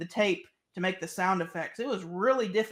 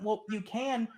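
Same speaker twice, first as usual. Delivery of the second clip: usual speaking voice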